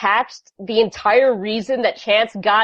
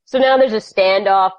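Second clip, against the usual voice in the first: ordinary delivery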